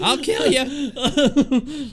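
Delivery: slow high laughing mock threat. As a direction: threaten while cracking up. Joking threat through laughter.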